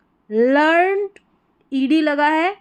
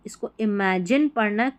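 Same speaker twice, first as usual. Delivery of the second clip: normal talking voice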